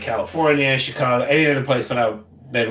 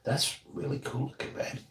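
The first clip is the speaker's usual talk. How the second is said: whispering; 4 semitones higher, 12 dB quieter than usual. Real, not acted. Whispered compliment to partner.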